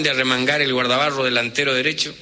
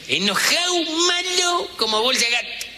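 pitched way up, mock angry; furious Italian. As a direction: ham up a mad Italian. Describing furious duelists. (italiano furioso, voz muy aguda en broma)

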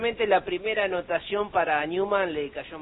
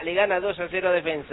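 ordinary delivery

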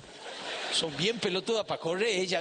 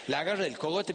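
ordinary delivery

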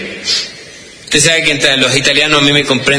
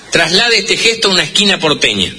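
ordinary talk

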